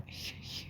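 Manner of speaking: ش as a soft unvoiced hiss of air; light breathy sh. Shown as a demonstration. Teacher demonstrating the German ch: airy hiss. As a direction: push air for ش with no voice. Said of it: "مش شين، لأ".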